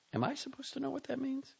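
high, cautious female voice; a puzzled partner; wary wife on phone. A narrator's reading. Sophie on the phone.

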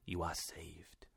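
slow, low, hushed reassurance; drawn-out, soft and low; tender relief. A narrator's reading. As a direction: soothe softly, almost whispering. Uncle tenderly embraces revived nephew.

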